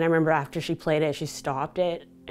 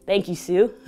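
higher, louder thanks melting into laughter; laughing thanks; 9 semitones higher, 9 dB louder than usual. Real, not acted. Thanking instructor Sue with a high laugh. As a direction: laugh warmly while thanking her.

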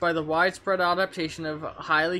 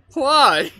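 loud, high cry of dismay; shocked shout; 8 semitones higher, 9 dB louder than usual. Real, not acted. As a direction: yell it in disbelief. Shocked at the game.

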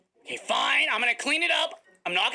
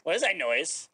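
ordinary talk

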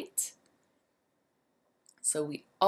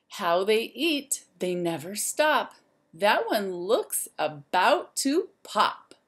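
lively, sing-song storybook voice; reading to children; 5 dB louder than usual. A narrator's reading. Teacher reading a rhyme book to the class, lively and sing-song. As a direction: wake the zoo up like a bright morning call.

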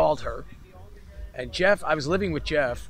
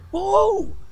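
much higher, louder gasp of disbelief; stunned whoa; 8 dB louder than usual. Retold or imitated. Hearing who left a message, shouting in surprise. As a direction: blurt out amazement. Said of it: "I was like".

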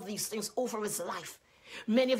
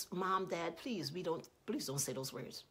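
soft, low, gentle plea; calm and nice; 3 semitones lower, 6 dB quieter than usual. Acted. Grandchild's parent asks the grandparents to watch their words, calm and nice. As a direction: ask gently, voice dropping softer. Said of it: "in a calm and nice way".

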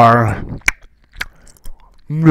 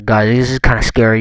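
ordinary talk, nothing marked

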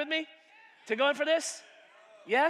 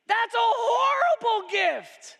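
shouted outrage; 10 semitones higher, 6 dB louder, slower than usual. Acted. Loud second take.

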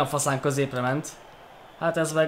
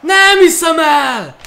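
high, slow, loud outcry; loud disbelief; 13 semitones higher, 10 dB louder, slower than usual. Real, not acted. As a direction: yell it out stretched in disbelief. Missed chance.

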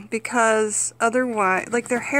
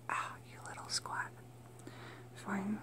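breathy whispered aside to the pet; fond whispered grumble at a stubborn pet; 4 semitones lower, 23 dB quieter than usual. Real, not acted. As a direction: whisper it, playfully exasperated. Muttering at stubborn chinchilla.

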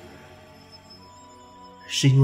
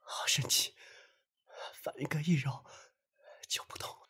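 very soft, breath-only relief; weak whisper; 10 dB quieter than usual. Acted. Telling the friend that rubbing eased the pain, weak whisper. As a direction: whisper it faintly, still recovering from pain. Pain easing after being rubbed.

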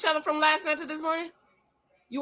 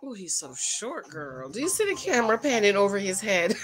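ordinary delivery

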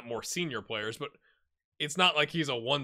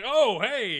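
lightly acted caught-off-guard cameraman, higher and louder; startled camera operator; 8 semitones higher, 7 dB louder than usual. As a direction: play a distracted cameraman suddenly noticing. Acts out distracted camera operator.

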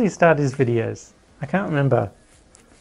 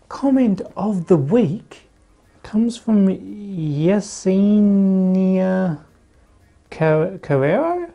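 announcer voice; 6 semitones higher, slower than usual. Acted. Announcing the comment segment.

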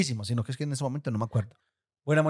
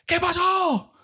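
much higher panicked shriek; dad's alarmed cry; slower than usual. Retold or imitated. Father startled by a story, shrieking in alarm. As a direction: jump in alarm like a startled dad. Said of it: "Mi papá grita".

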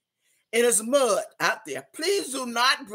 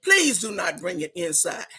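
ordinary delivery

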